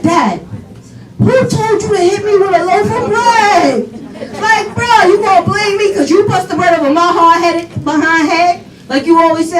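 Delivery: high-pitched comic outrage; playful acted-out indignation; indignant protest. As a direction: talk back to dad in mock disbelief. Child protests bread hit.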